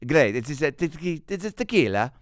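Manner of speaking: mock Italian accent, quick and choppy. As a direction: put on a comic Italian accent. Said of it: "in an Italian accent".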